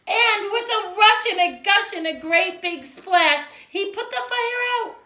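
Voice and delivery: loud high rush; triumphant. A narrator's reading. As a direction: splash it out with glee. Triumphant rescue moment.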